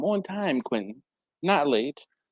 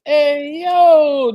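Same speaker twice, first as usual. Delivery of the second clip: high, loud hey yo; hype greeting; 10 semitones higher, 7 dB louder than usual. Real, not acted. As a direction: shout it like greeting a buddy. Reading viewer greeting energetically.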